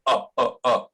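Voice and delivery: "A, a, a" as short relaxed uh sounds; schwa. Shown as a demonstration. Teacher repeating the schwa like a baby's first sound. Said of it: "el sonido schwa".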